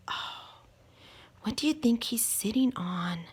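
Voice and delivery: whispered, hushed question; suspenseful whisper. Acted. Reader asks the children where George sits, whispered.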